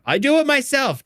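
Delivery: put-on high insistent toddler voice; the little one insisting on doing it alone; stubborn toddler. Toddler quoted.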